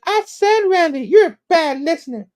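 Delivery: very high tipsy falsetto; hammed-up drunken lady complaint; drunk weird lady. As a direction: scold a friend in a squeaky drunk voice. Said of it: "she's like".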